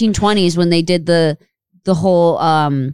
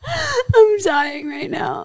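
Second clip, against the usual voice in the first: much higher, slow, wheezing through laughter; laughing hard; 12 semitones higher, 4 dB quieter, much slower than usual. Real, not acted. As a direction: barely get the words out for laughing. Laughing after being roasted.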